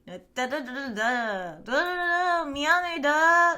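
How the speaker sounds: high, loud whiny mock Korean; whiny Korean imitation. Streamer showing viewers how Korean sounds to her, mock whiny. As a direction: whine out nonsense syllables like caricatured Korean. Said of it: "it sounds so whiny".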